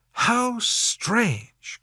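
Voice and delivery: put-on high, startled young lady's cry; Elizabeth crying out. A narrator's reading. Elizabeth to Wickham, startled outcry. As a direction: burst out in amazement. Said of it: "cried Elizabeth".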